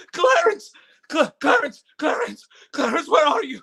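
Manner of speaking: crying out. Acted. Lost man begging his angel to return, sobbing. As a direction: call out for him through tears. George calls for the angel in despair.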